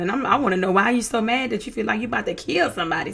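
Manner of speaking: ordinary talk, nothing marked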